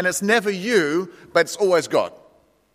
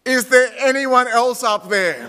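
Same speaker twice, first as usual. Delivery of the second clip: higher, slow desperate yell upward; 5 semitones higher, 3 dB louder, slower than usual. Acted. Tourist shouting to heaven for another answer, higher and slow. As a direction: call to the sky for someone else. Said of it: "a tourist yelled".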